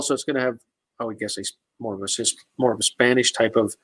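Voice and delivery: ordinary talk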